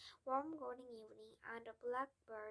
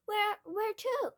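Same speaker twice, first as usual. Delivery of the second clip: 1 anxious plea; 10 semitones higher, 13 dB louder, slower than usual. A narrator's reading. Matilda questions father.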